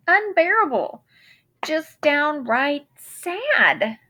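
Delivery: plain reading voice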